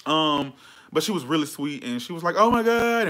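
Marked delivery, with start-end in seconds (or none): 2.4-3.1 excited fan gasp. Retold or imitated. Fan gasping on seeing her.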